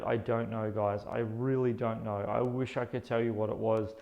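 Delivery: ordinary delivery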